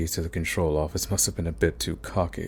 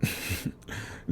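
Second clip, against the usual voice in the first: breathy laugh; 12 dB quieter than usual. Acted. Villain scoffs before hearing proposals.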